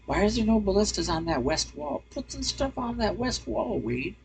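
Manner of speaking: mock scold yourself, way up high; nagging critic voice. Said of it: "I love criticizing myself after the fact".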